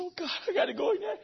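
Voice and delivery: a hint of a frightened soul; reluctant self. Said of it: "I said".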